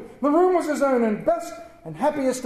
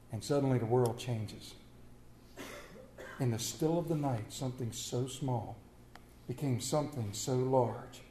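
much softer, lingering on each phrase; hushed refrain; 8 semitones lower, 11 dB quieter than usual. Acted. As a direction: tell it like a sacred secret. Sermon refrain repeated softly.

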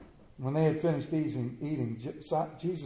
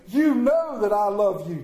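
loud, high declaration of love; brash Peter; 4 semitones higher, 8 dB louder than usual. Acted. Peter's first answer to Jesus, brash and loud. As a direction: proclaim it boldly, sure of yourself. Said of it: "He's all brash. The first statement".

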